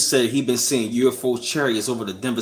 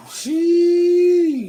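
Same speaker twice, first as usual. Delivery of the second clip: impressed sheesh; 4 dB louder than usual. Real, not acted. Reacts to pendulum.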